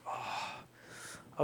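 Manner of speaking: very quiet, defeated exhale; resigned sigh. Retold or imitated. Man giving in to God's choice, resigned sigh. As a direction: breathe out a soft, unvoiced "oh".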